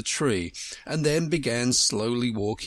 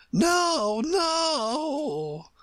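very high, long-drawn queen's cry; urgent cry. A narrator's reading. Queen urging Alice to run, high urgent cry. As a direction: call it out high and stretch it. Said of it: "cried the Queen".

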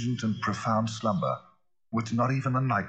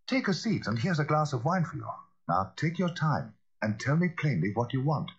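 raised-pitch, measured gentleman's voice; lawyer settling a visitor; 8 semitones higher than usual. Acted. Lawyer offers frightened Poole a seat and wine, coaxing. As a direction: coax him to speak, pitch lifted.

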